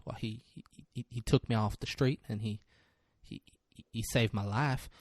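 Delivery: low, softly stammering witness; halting witness. Acted. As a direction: stumble gently over the opening words. Retelling a timid witness's halting testimony.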